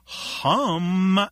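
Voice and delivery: stretched-out, hammed-up snooty correction; snotty. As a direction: correct him with mock superiority. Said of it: "as snotty as possible".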